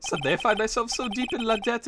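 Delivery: hammed-up French chef accent; a hint of a comic French chef.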